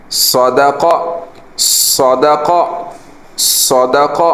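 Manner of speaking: صدق with heavy ص and no stretched vowel; even short vowels. Shown as a demonstration. Teacher models صدق for students, crisp and even. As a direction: drill صدق three times, crisp and even. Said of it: "نہ صاد کو لمبا کرنا ہے".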